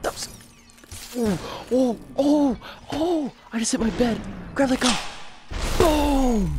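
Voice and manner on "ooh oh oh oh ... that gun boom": excited. Real, not acted. Panicked VR shootout, yelling.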